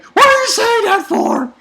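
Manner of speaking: high, loud freaked-out shriek; loud, high panicked yell; panicked Usopp. Retold or imitated. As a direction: shriek it like a terrified coward. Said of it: "And Usopp's like".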